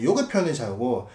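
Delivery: ordinary delivery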